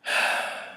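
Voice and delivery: long weary exhale; soft, weighted sigh; heavy sigh. A narrator's reading. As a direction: heave a heavy breath out. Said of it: "I heave a heavy sigh".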